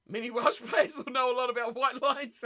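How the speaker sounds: chuckling through the joke; laughing. Real, not acted. Joking about Welsh players, laughing. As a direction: laugh as you land the dig.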